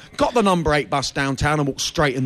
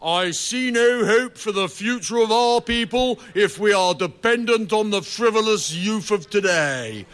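put-on lightly raised, lamenting old sage; mock bewailing; 3 semitones higher than usual. Comic reading an ancient Greek lament, mildly solemn. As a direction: intone it like a gloomy ancient text. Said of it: "bewailing litany which reads".

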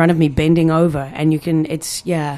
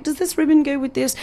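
prim bonnet-shop voice; 10 semitones higher than usual. Retold or imitated. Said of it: "We were like".

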